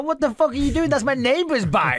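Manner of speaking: high-pitched retold outrage; exasperated scolding. Telling off a friend who took the neighbour's bike, exasperated. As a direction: exclaim it high in mock disbelief.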